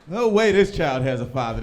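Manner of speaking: ordinary talk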